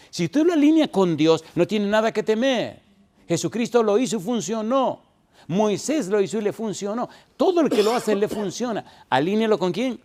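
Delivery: fiery preaching. Acted. Preacher urging the congregation, in full fiery preaching. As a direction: preach it hard to the whole church.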